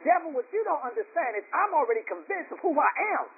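high, emphatic pulpit delivery; fired-up preaching. Real, not acted. Preacher telling the devil he is already convinced, fired-up preaching.

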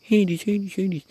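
very high, loud put-on voice; mock frantic calling of a dog; mimicked woman calling. Other owner calling the wrong dog, shrill mimicry. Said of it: "She was calling my dog".

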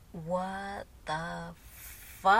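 slow-motion retold shock; stunned shock. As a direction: drag out each word, stunned. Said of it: "in shock".

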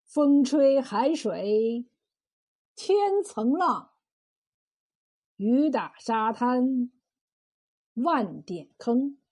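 slow, drawn-out chant of verse; poetic recitation. A narrator's reading. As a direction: recite each phrase like a classical poem. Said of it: "不由得吟诵起来".

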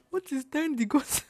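high, laughing imitation of an outraged director; incredulous director, hammed up through laughter; mock-mad director. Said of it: "the director was so mad".

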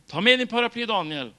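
insistent repeat. Retold or imitated. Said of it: "så kommer det en gång till". (högre, enträgen upprepning)

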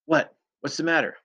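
high, urgent questioning; startled. A narrator's reading. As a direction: react with sudden concern. Startled reply to sudden exclamation.

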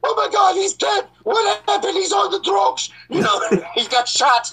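very high, frantic mom voice; panicked mom. Retold or imitated. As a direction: shriek like a mother in a panic. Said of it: "I know my mom is like".

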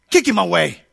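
a brisk mock dismissal from the wife; wife brushing him off. Wife waving off a pushy beggar, lightly mocking. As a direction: flick him off like a pest. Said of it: "she goes".

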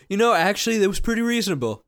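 high, chatty corpse voice; dead man voice. Pretend. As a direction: play the buried guy agreeing cheerfully.

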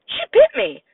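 sudden high, loud yelp of pain; a grandmother's startled outcry; shocked cry. Acted. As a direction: cry out in shocked surprise at the bite. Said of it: "Grandma cried".

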